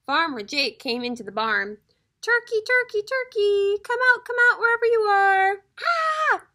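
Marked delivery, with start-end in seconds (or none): 2.2-5.6 high, loud singsong call. A narrator's reading. Farmer calling for hidden turkey. 5.8-6.5 high, held cry; short scream. A narrator's reading. Cry during the turkey hunt.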